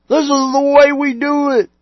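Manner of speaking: loud, high, smug mock voice; mock pastor. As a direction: parody the pastor's pat answer. Mocking complacent pastors.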